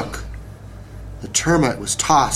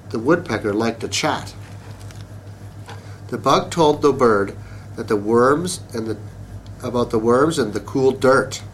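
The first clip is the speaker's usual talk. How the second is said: ordinary delivery, nothing marked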